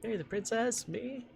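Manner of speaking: high startled prince voice; surprised prince. Acted. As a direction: squeak it out, startled.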